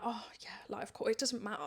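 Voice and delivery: near-whispered realisation to oneself; very soft, whispery inner voice; hushed self-reassurance. Acted. Woman reminding herself age doesn't matter on the dance floor, hushed self-reassurance.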